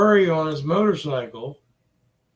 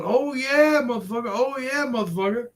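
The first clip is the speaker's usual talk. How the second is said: a higher, mocking taunt, rising at the end; lightly voiced cocky biker, hammed up to mock him; imitating a cocky biker; 6 semitones higher, faster than usual. Mocking the rider's taunt from his video, a higher mock voice. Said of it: "on his motorcycle going".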